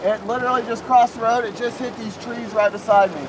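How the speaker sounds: high, loud, breathless storm report; excited shouting. Real, not acted. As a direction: shout it out as the tornado hits. Tornado crossing road beside chaser.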